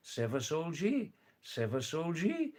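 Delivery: Seva Solji with the pitch climbing high on Solji; question tune rising. Shown as a demonstration. Teaching a Tamang phrase, voice rising on the question. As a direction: lift the voice up through Solji like a question. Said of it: "The voice is going up with the question".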